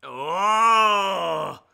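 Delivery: sudden loud startled "oh"; loud cry of surprise. Real, not acted. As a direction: gasp out loud in shock. Reacts loudly to promo.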